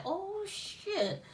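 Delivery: high startled yelp, then soft; sudden high-pitched alarm. Retold or imitated. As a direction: jump at it, high and breathy. Cop touches the car.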